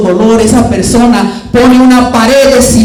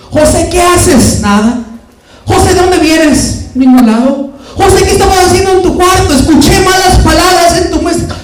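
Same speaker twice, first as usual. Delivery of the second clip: higher, insistent mom calling; nagging mother; 6 semitones higher than usual. Retold or imitated. Mother questioning son José, higher nagging. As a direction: pester him with questions.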